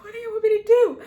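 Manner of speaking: high, loud re-enacted cry; panicking mom. Mom on her phone beside daughter, panicked high cry. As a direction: cry out helplessly with phone in hand. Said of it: "sitting there panicking".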